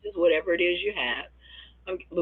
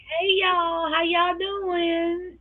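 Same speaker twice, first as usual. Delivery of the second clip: very high, slow drawn-out hello; sing-song greeting; 14 semitones higher, much slower than usual. Retold or imitated. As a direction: stretch the greeting out sweetly. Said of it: "she would just get on and say".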